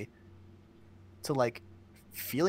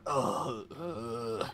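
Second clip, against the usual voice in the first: disgusted groan. Acted. Someone hearing their own voice played back, groaning in disgust. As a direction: groan as if cringing at yourself.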